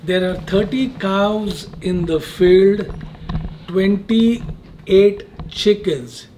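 unhurried, with big gaps between phrases; measured quizmaster delivery; slow, deliberate riddle reading. Real, not acted. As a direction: spell it out word by word. Host re-reads riddle slowly before answer.

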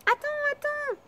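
high calling cry; frog calling out. Acted. Frog crying out after the fleeing princess, high call. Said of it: "criait la grenouille".

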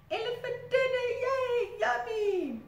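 high, loud gleeful crocodile; greedy crocodile. Acted. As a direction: squeal it with greedy delight. Hungry crocodile voice.

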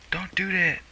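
high, loud panicked yell; shouting. Real, not acted. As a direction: yell it out in alarm. Yelling at the game.

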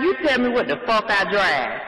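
ordinary delivery

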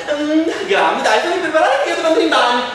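high, loud fussing mum with ehm; flustered mother. Acted. Mother voiced. (mamma agitata, acuta e forte)

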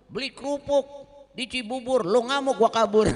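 loud, high rhyming punchline; joking pantun. Acted. Preacher joking about running off, loud and high. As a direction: deliver the rhyme as a cheeky joke. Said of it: "ada pantun-pantunnya juga".

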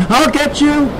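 very high, determined cry; shouting kid. A narrator's reading. Kid passing Dad in the waves, shouting. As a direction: shout across the water. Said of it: "the kid shouted".

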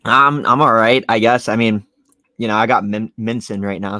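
talking around a mint; words round a mint in mouth; mouth full. Real, not acted. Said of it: "sound like my mouth is full".

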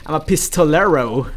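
put-on "pistolero" rolled out in a playful Spanish accent. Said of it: "I said that with an accent".

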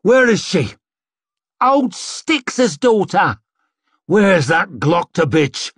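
high, loud, furious demands; angry mob shouting. A narrator's reading. Rioters hunting for Styx's daughter, angry shouting.

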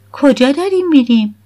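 put-on a pushy girl asking where they're going; lightly voiced demanding girl; suspicious teen. A narrator's reading.

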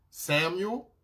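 lightly acted calling voice, rising at the end; retold call. The Lord calling young Samuel in bed, lightly voiced call. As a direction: imitate someone calling a sleeper by name.

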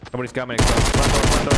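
loud urgent warning; urgent shout. Real, not acted. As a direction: call it out loud and fast. Warning teammate of enemy.

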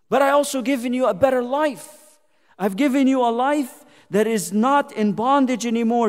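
fervent pulpit declaration; preaching at full force. Acted. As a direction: announce God's deliverance. Voicing God loudly.

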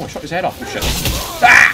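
normal talking voice

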